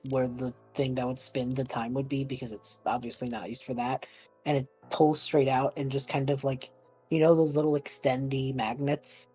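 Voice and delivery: ordinary talk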